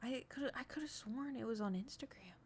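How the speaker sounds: whispered half to herself; breathy whisper while looking. Real, not acted. Muttering while searching.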